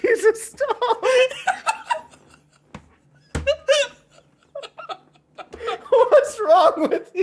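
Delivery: laughing hard at a crude joke; high laughing protest. Real, not acted. Friend laughing in shock at the Jesus joke. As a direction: squeal it out through laughter.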